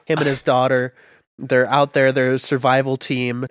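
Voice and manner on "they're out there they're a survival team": usual speaking voice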